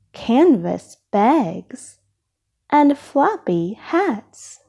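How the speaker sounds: ordinary delivery, nothing marked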